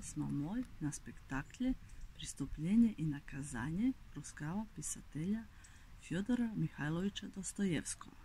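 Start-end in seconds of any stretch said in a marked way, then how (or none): none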